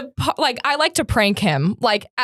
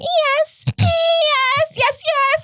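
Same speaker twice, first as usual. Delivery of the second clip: very high, teasing squeals; mock escalating yeses; much slower than usual. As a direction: keep teasing, pitched way up and insistent. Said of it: "getting louder and louder".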